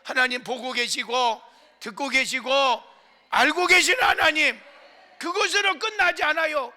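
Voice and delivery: loud, rising shouted litany of praise; a pastor roaring to the congregation; full-force preaching. Acted. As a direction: build each 하나님 line into a shout.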